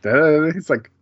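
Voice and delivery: ordinary delivery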